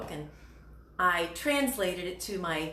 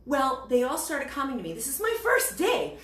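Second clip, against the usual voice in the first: ordinary delivery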